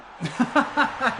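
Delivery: high, loud burst of real laughter. Real, not acted. Laughing at his striker on holiday, a high loud burst. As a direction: crack up suddenly at the thought.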